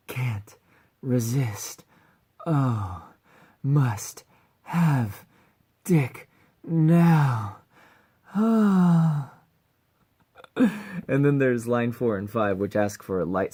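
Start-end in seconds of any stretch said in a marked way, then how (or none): 0.1-7.6 overcome with craving. Acted. Cat girl reacting to a human's scent, overcome, building louder. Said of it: "Having a strong reaction to the scent of a human". 8.3-9.3 loud, high drawn-out "ah"; overcome cry. Acted. Cat girl reacting to a human's scent, loud overcome cry. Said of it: "Having a strong reaction to the scent of a human".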